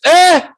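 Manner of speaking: sudden high, loud cry; loud exclamation. Real, not acted. Host recalling seeing guest in the play, overcome cry. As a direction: burst out with a high "ah".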